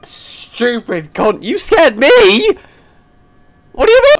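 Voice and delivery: loud, high-pitched indignant yell; shrieking in shocked protest; startled outrage. Real, not acted. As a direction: yell at whoever scared you. Yelling at whoever startled them.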